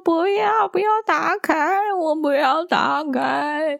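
put-on high, wailing child's cry, slowing on the last line; 学小女孩大哭着说不要; imitating a crying toddler. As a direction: mimic a little girl sobbing no. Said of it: "还给我哭得超大声".

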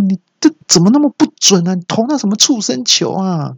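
mock outraged cry held up high; acted shout. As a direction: holler a complaint at a bad pitcher. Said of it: "那我就大喊说".

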